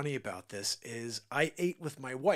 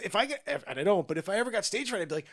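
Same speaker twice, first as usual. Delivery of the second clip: ordinary talk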